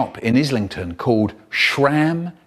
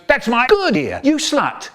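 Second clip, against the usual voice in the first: angry; 9 semitones higher than usual. Retold or imitated. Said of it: "And he goes".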